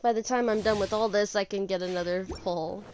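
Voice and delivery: ordinary talk, nothing marked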